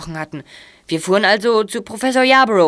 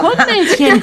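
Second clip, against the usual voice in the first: mocking singsong; 11 semitones higher, 4 dB louder, slower than usual. Mocking childhood nickname.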